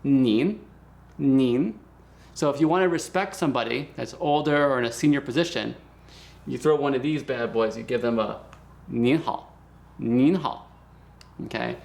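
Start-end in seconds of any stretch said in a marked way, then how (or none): 0.0-1.8 "nin" drawn out, rising, with a clear final n; slow, firm teaching "nin"; pronunciation demo. Shown as a demonstration. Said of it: "And that is nin". 8.9-10.7 "nin hao" with the n held before "hao"; stressed final n. Shown as a demonstration. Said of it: "Make sure you stress this n".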